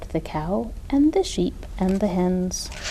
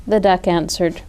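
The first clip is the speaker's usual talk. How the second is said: plain reading voice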